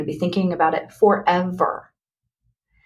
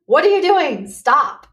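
a hammed-up inner scream; the frantic voice in her head yelling stop; mock scream; 6 semitones higher than usual. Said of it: "that inner voice that was screaming".

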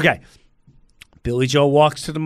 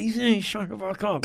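muttered threat; 3 semitones higher, 8 dB quieter than usual. Retold or imitated.

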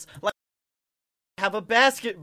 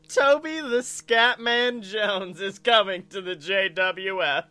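slow, drawn-out hype announcement; mock wrestling announcer; 3 dB louder, slower than usual. Co-host announces his invented scatting wrestler, slow mock announcer hype. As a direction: stretch the name like a ring announcer.

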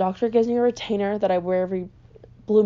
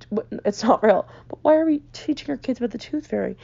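ordinary talk, nothing marked

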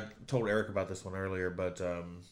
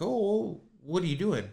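sudden high startled dad; alarmed dad; 9 semitones higher, 5 dB louder than usual. Retold or imitated. Dad catching his kid at the door, high and alarmed. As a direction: jump up high in alarm, louder. Dad voiced in retold story.

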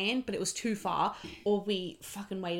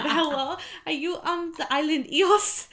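put-on fuller Greek accent, much higher and louder, rising at the end; 8 semitones higher, 7 dB louder than usual. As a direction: commit to the Greek girl on the phone. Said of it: "sorry about this accent".

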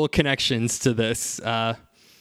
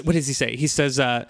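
ordinary talk

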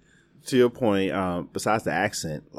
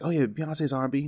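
higher-pitched quote of someone else; quoted claim; 7 semitones higher, 4 dB quieter than usual. Retold or imitated. Podcaster quoting someone's claim about [name], lightly mimicking.

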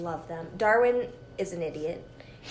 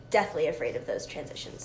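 normal talking voice

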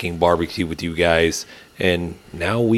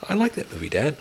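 daughter's voice; 7 semitones higher, 9 dB quieter than usual. Retold or imitated. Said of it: "She's like".

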